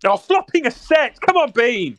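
high, loud excited cheer. Real, not acted. Excited at good flop.

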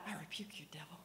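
soft, hushed command under the breath; quiet rebuke. Retold or imitated. Over the man on the floor, not wanting a scene, quietly. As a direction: say it low so nobody notices. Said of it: "'cause I didn't wanna make a scene".

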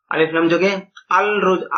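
usual speaking voice

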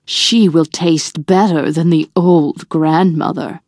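low, sly wolf voice; wolf scheming. A narrator's reading. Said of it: "he thought".

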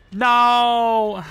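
long, loud, high "no"; dismayed cry. Real, not acted. Cries out as things go wrong.

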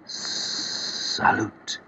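whispered little lisp. Acted. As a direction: lisp it softly like a slender canoe. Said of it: "lisped the slender canoe".

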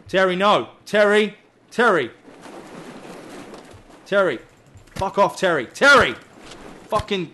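real furious yelling at Terry; yelling at shark. Real, not acted. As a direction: bellow at the shark to back off.

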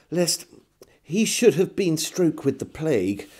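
ordinary delivery, nothing marked

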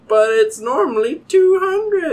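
high, loud, drawn-out brag; mock defensive boast. Him defending his hoodie to the stranger, boastful.

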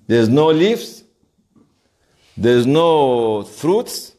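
ordinary talk

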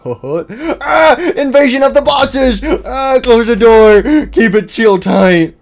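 high, loud laughing shouts; laughing panic. Real, not acted. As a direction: cackle while yelling orders. Boxes invade, laughing panic.

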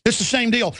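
usual speaking voice

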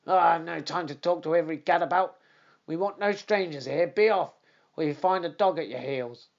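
bullying trainer threatening Holmes with the dog; angry trainer. Acted. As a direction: brush off the stranger with threats.

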